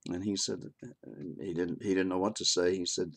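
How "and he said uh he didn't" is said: normal talking voice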